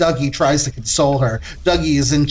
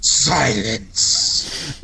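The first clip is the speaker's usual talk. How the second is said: low, quiet commanding word; stern command; 4 semitones lower, 3 dB quieter than usual. Acted. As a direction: hush them coldly. Ghost commands silence.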